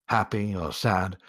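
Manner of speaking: usual speaking voice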